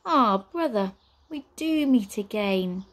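slow, smug witch drawl; sly witch voice. Acted. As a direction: tease your brother like a witch.

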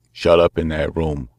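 growled. Acted. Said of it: "growled a voice".

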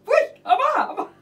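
laughing, fast. Real, not acted. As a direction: laugh through the words. Reacting with laughter.

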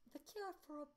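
put-on very high British accent, broken off; trying to find the high British mother voice; mother's British voice. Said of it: "I forgot her voice".

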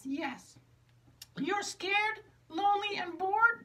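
very high, gentle puppet reassurance; caring high puppet. Acted. Charcoal reassures sad Magenta, very high and kind. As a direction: comfort her as a sweet little puppet.

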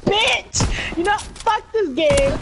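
furious outburst, loud and high; angry. Real, not acted. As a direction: yell it out in real rage. Rage quitting after being sniped.

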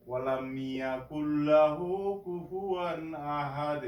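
slow, melodic chanted recitation; Quran recitation. Shown as a demonstration. Teacher reciting Sura Ikhlas for learners, chanted recitation. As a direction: recite with long drawn-out vowels.

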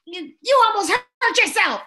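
high, loud mock yell; angry parent. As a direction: scold your child in alarmed anger. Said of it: "where parents get angry".

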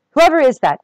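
loud, high call through the door. Acted. Buttercup calls through locked door.

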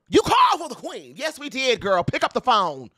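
high, loud sassy call. Real, not acted. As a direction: shout it at her, sassy. Calling out to [name].

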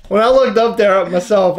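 ordinary talk, nothing marked

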